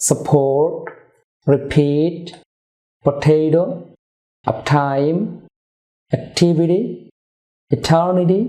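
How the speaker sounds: সাপোর্ট রিপিট পটেটো with a puff on the stressed consonant; aspirated stressed p t k. Shown as a demonstration. Teacher drilling mid-word aspiration for viewers. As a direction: breathe out on the p, t or k of the stressed syllable. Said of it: "ওয়ার্ডের ভিতরে stress level হয়ে থাকে".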